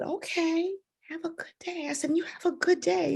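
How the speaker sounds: put-on high, soft sweet farewell to a child; gentle goodbye. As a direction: send a little one off kindly.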